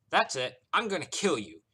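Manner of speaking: high, loud burst of temper; furious threat. A narrator's reading. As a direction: explode as patience runs out. Driver snaps, threatens to kill.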